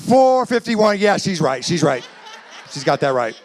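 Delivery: hammed-up hasty backtrack; husband correcting himself. Comedian voices a husband caught miscounting years married, hastily agreeing. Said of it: "his mouth started to form a four".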